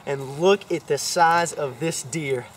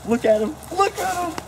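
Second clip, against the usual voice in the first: excited; 12 semitones higher than usual. Real, not acted. Seeing the deer up close.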